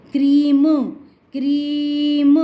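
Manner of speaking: क्रीम with a long stretched ee and a released m; drawn-out demonstration. Shown as a demonstration. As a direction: slowly stretch क्रीम out for a pupil to hear. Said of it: "देखो sound सुन रहे हो". (क्रीम को लंबी ई के साथ खींचकर बोलना)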